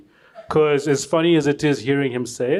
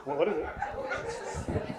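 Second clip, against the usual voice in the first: laughing whisper; 3 semitones higher, 12 dB quieter, much slower than usual. Real, not acted.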